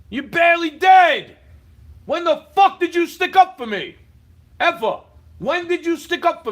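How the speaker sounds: loud, furious accusation; yelling at the neighbor in fury; angry shouting. Real, not acted. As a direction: shout it at her in real anger.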